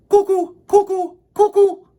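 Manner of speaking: high loud cuckoo clock call. Acted. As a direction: sing it out like a cuckoo bird. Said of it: "popped out ... and said".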